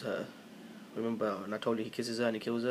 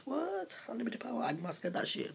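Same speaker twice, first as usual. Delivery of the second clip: high-pitched imitation of shocked onlookers; exaggerated high voice of a stunned crowd; mock shock; 12 semitones higher, 5 dB quieter than usual. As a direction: squeak it in hammed-up disbelief. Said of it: "they all look shocked".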